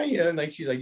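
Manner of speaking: normal talking voice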